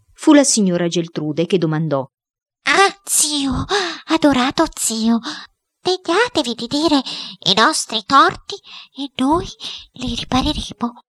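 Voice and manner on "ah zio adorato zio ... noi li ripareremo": high, broken-up phrases of entreaty; pleading headmistress. A narrator's reading. Headmistress begging her adored uncle to speak, high and pleading. Headmistress begs the spirit, overcome by remorse.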